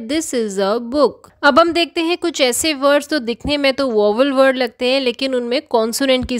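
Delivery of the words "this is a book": slow example sentence. Shown as a demonstration. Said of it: "इसलिए यहाँ पर ए आया है".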